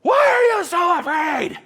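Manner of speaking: high, full-force shout; yelling over storm. Acted. Jesus to disciples while the storm rages, yelling. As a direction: holler to be heard over a raging storm. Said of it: "he's gotta yell this 'cause the storm's still going on".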